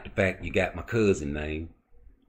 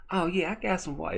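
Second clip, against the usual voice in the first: Miss Parker inviting; 12 semitones higher, faster than usual. Retold or imitated. Retold skit, Miss Parker inviting.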